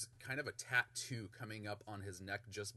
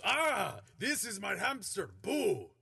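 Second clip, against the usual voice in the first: loud, higher proud introduction; Minsk voice; 7 semitones higher, 5 dB louder than usual. Acted. GM as Minsk presenting his hamster Boo, loud and proud. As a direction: show off your pet proudly. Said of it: "He goes".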